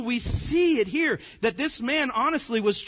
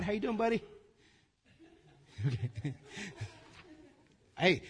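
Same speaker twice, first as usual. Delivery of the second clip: ordinary talk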